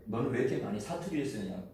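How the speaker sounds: ordinary delivery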